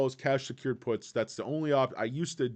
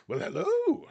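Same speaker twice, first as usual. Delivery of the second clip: much higher, louder flourish of a hello; mock grand greeting; 5 dB louder than usual. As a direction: greet him like a Renaissance fair courtier. Greeting Lars of Nottingham comment.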